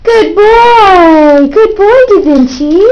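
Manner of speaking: high excited praise; squeaky praising a dog. Real, not acted. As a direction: gush over the pet.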